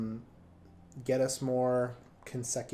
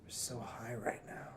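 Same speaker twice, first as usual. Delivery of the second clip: whispered stoner voice, spaced out; breathy stoner whisper, zoned out; 2 semitones lower, 13 dB quieter than usual. Pretend. Playing stoners with toys.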